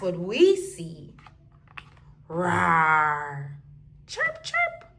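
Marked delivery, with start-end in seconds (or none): 2.3-3.5 low growling rawr; bear roar. Pretend. Reading to children, a low bear growl. Animal sounds after brown bear. 4.1-4.9 very high, soft chirping; bird chirp. Pretend. Reading to children, a tiny high bird chirp. Animal sounds, red bird.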